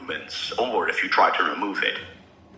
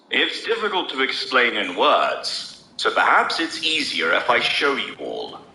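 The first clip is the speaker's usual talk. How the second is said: sneering; 2 semitones higher than usual. A narrator's reading. Gideon taunts the prisoners before revealing the truth, sneering. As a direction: savour their ignorance with a sneer. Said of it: "I heard Gideon sneering".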